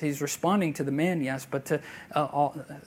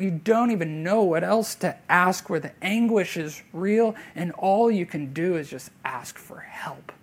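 ordinary delivery

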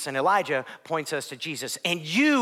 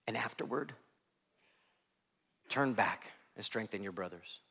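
much softer, hushed promise; tender Jesus; 8 semitones lower, 13 dB quieter than usual. Acted. Jesus quietly assuring Simon he prayed for him, soft and tender. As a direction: speak it gently, close and intimate.

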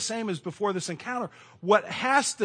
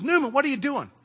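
higher, louder mock challenge; marshal's boss confronting Newman, louder and higher; boss calling out; 9 semitones higher, 6 dB louder than usual. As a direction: bark the question at him like a boss. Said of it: "he goes".

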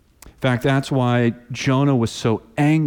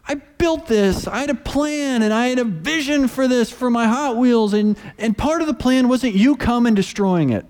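put-on hurt boy; 10 semitones higher than usual. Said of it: "he's thinking".

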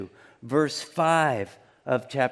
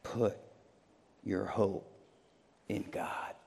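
hushed; 6 semitones lower, 11 dB quieter than usual. Acted. Preacher answering the lament, lower and softer to a whisper.

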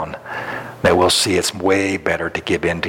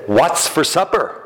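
calling out; 8 semitones higher, slower than usual. Retold or imitated. Husband calling again, closer.

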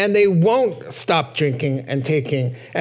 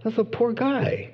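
sympathetic gasp; 6 semitones higher than usual. Retold or imitated. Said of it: "you can see him like".

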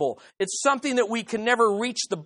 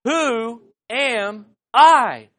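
slow, spaced-out words, louder; very slow, emphatic call to repeat; leading a recitation; 5 dB louder, much slower than usual. Shown as a demonstration. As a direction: lead the congregation word by word. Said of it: "Let's say that".

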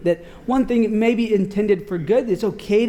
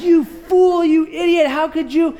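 angry retort; 7 semitones higher than usual. Retold or imitated. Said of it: "And I'm just".

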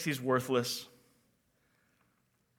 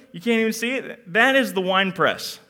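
usual speaking voice

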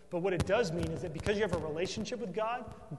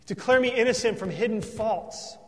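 louder, fast, impassioned preaching; fervent preaching; 5 semitones higher, 9 dB louder than usual. Acted. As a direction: preach it full force from the pulpit. Preaching at full force.

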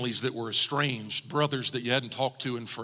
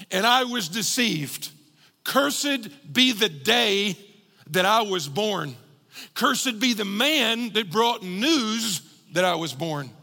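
higher, slow, weighty lament; Jeremiah in pain; 5 semitones higher, 3 dB louder, slower than usual. Retold or imitated. Preacher as Jeremiah cursing his birth, slow pained lament. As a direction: curse it out slowly, word by word. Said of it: "going through intense pain".

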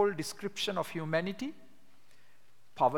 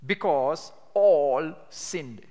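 "all" stretched long and loud; stress on all; 6 dB louder, much slower than usual. Shown as a demonstration. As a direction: lean hard and slowly on the word "all". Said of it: "I would circle the word all".

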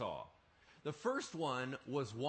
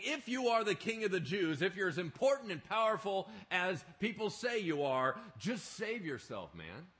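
a hint of jeering soldiers, higher; mocking soldiers; 6 semitones higher, 6 dB louder than usual. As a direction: taunt the king mockingly. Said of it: "offering him sour wine and saying".

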